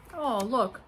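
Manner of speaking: ordinary talk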